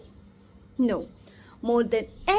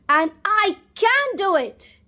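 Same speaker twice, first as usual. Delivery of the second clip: louder and higher, ringing out; loud affirmation; 7 semitones higher, 5 dB louder than usual. Shown as a demonstration. Coach leading the audience in a chant, loud and higher. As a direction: call it out with full conviction. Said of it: "repeat after me loudly".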